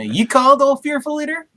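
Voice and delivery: high, sing-song sarcastic greeting; a cheeky sidekick needling his leader; mocking. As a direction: tease the boss mockingly. Mocking the captain.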